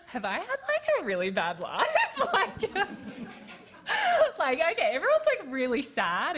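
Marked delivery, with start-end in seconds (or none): 0.1-2.6 laughing through the words, pitch jumping way up; real giddy laugh breaking through the question; laughing disbelief. Real, not acted. Laughing at her own crying. 2.7-4.3 long high laugh, falling away; real high-pitched laughing fit; high burst of laughter. Real, not acted. Laughing at her own crying.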